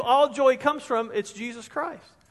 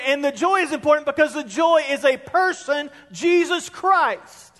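higher-pitched, insistent pulpit delivery; emphatic preaching; 6 semitones higher, 3 dB louder than usual. Real, not acted. Preacher builds to key point.

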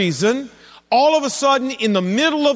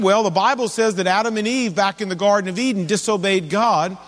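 ordinary talk

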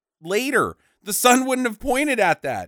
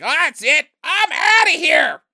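higher, louder, fed up shout; mock storming off; 8 semitones higher, 5 dB louder than usual. Son quitting the quest, a mock angry shout. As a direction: yell it as you storm out. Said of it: "And the son's like".

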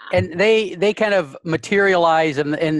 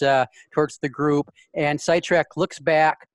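ordinary delivery

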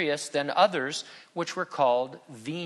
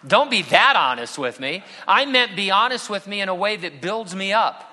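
mock protest; 6 semitones higher, 7 dB louder than usual. Mock protest about honesty.

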